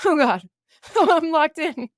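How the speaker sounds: high, loud, laughing panic, slowing on locked in; half-laughing alarm at being trapped. Retold or imitated. Locked in his house on a bad date, high loud laughing panic. Hears the door lock, panics.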